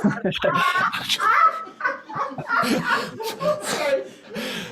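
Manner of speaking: real high-pitched burst of laughter; long, high, uncontrolled laughing fit; big laugh. Real, not acted. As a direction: crack up helplessly and keep going. Both break up over the mix-up.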